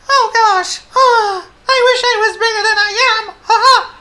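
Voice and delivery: very high, loud wailing tiny mouse; Squeak. A narrator's reading. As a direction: squeal it as a tearful little mouse. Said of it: "he sighed".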